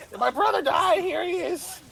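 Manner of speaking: high, shouted character voice; voiced game character. Game character producing his brother's ashes, high put-on shout. Graw's acted reply in retold scene.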